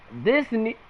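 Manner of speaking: words broken by a hiccup. Real, not acted. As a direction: cut off by a hiccup. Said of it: "excuse me, I got the hiccups now".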